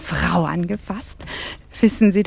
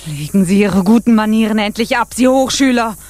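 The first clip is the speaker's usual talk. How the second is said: louder, sharp reproach; angry outburst; 4 semitones higher, 7 dB louder than usual. Acted. The officer loses patience with her, angry. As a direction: bark it at her.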